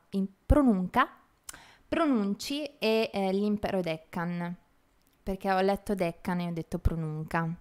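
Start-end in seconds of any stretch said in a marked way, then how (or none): none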